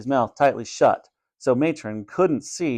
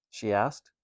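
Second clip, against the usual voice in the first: ordinary delivery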